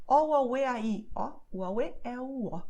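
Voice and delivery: O, a, o, e, a, i, o said as clean separate vowels; vowel-only phrase. Shown as a demonstration. Teacher sounding out the vowel phrase for learners. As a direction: sound out each vowel, mouth wide open. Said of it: "the phrase is the one that you see here below".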